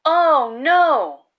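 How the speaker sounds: ordinary delivery, nothing marked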